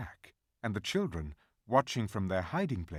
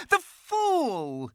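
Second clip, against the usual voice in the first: high, loud witch voice crying out; the witch cries out in triumph; 7 dB louder than usual. A narrator's reading. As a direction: cry it out as a gloating witch. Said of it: "she cried".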